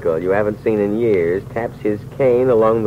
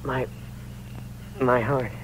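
halting, softer gasp of pain; weak with pain; 2 semitones higher, 10 dB quieter than usual. Acted. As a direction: squeeze the words out through a heart attack. Dying man clutching his heart.

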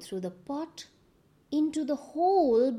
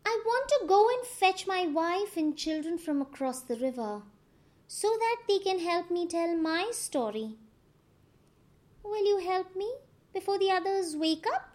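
higher, quick trickster voice; Anansi's voice; 6 semitones higher than usual. A narrator's reading. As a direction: make excuses like a sly spider.

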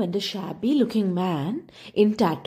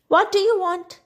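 sharp demand; 12 semitones higher than usual. A narrator's reading. Grumpy old woman demanding from inside her cottage, sharp and high. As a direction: snap it at the stranger through the door. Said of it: "a sharp voice demanded".

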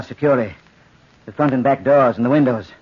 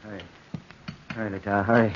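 put-on old man mumbling; 6 semitones lower, 5 dB quieter than usual. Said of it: "the old man muttered".

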